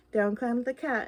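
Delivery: ordinary delivery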